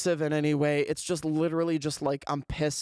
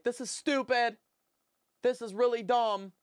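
pissed, pitched up, no real shouting; mock self-yelling; 7 semitones higher than usual. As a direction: grumble at yourself in mock outrage. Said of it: "I, like, yell to myself".

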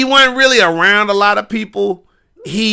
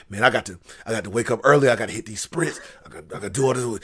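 low, soft pep talk to himself; muttered self-talk; 8 semitones lower, 10 dB quieter, faster than usual. Retold or imitated. Wrestler alone at the hotel before matches, muttered self-talk. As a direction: murmur a to-do list under the breath.

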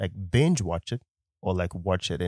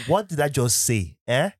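Nigerian English, higher and emphatic; Nigerian accent; 7 semitones higher than usual. Acted. Husband mocking his own slip on the podcast, Nigerian accent. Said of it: "say it with the wrong accent".

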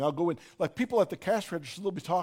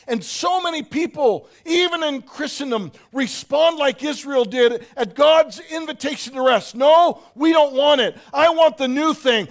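loud, higher shouted preaching; full-force preaching; 8 semitones higher, 12 dB louder than usual. Acted. Preacher rebuking the congregation, shouting from the pulpit. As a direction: thunder it to the room.

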